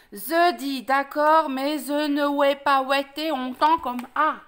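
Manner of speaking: slow, garbled, lisping wolf; talking open-mouthed. Acted. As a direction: force the words out with mouth held open. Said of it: "Reste comme ça". (parler lentement, la gueule ouverte)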